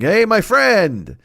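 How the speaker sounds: drawn-out, high hearty greeting; old deli man. Retold or imitated. Deli owner greets a walk-in customer, drawn-out old-man hail. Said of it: "And he's like".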